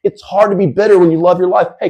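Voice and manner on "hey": normal talking voice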